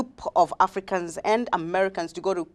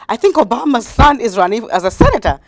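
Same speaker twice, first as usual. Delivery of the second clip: higher, louder eager report; excited call to dad; 5 semitones higher, 7 dB louder than usual. Retold or imitated. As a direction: tell dad the news eagerly. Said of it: "I'm like".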